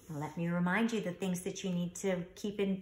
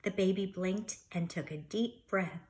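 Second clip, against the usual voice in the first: lively, expressive storybook reading; reading to children; 2 semitones higher, 8 dB louder than usual. Acted. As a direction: read it like story time for a class. Teacher reads picture book aloud.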